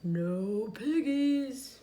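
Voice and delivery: slow, lowing cow; slow, drawn-out cow answer. A narrator's reading. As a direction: drag it out like a sleepy cow. Cow's slow reply.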